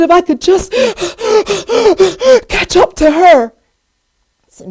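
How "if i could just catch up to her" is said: loud, high, gasping between phrases; acted breathlessness with long panting breaks. Winded runner chasing the one ahead, imitated panting. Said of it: "and she's panting".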